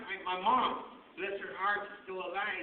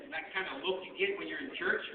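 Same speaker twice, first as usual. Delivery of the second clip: ordinary talk, nothing marked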